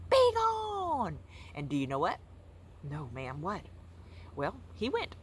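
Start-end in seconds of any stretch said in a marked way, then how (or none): 0.1-1.2 shrill, loud high shout; screamed command. Acted. Librarian screaming at a bear in her library, loud high shout. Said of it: "And then I screamed".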